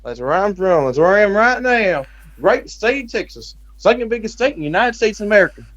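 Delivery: put-on high-pitched, proud Texan accent. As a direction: boast about home in an exaggerated Texas twang. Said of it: "people who talk like that".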